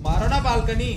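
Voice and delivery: urgent order. Real, not acted. Player urging teammates to shoot the balcony, shouted order. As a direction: yell at your squad to open fire.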